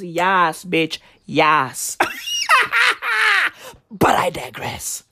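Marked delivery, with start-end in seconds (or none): 0.0-2.0 low, drawn-out approving chorus; a hint of a crowd cheering on; table chorus. Friends at the table cheering him, low approving. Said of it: "everybody around the table goes". 2.0-3.5 high burst of laughter. Real, not acted. Comic cracking up at his own bragging, high laugh.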